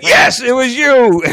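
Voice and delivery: high, loud, drawn-out mock outrage; indignant. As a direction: accuse him loudly in a hammed-up retelling. Retold indignant confrontation of man.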